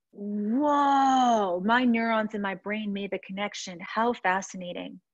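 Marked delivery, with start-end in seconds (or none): 0.1-1.6 a high, drawn-out whoa of sudden realization; inner whoa. Retold or imitated. Thinking back on a dad's girlfriend's remark, surprised whoa. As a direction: let the whoa rise high as it clicks. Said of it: "in my head, went".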